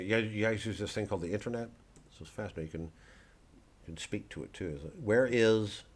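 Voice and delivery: normal talking voice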